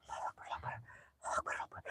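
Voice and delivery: voiceless frightened prayer; hushed prayer in fear; whispered prayer. Real, not acted. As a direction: whisper the prayer to calm yourself. Praying in fear at night.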